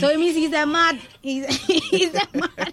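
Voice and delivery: laughing punchline. Real, not acted. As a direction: crack up mid-sentence. Laughs at the singer's quote.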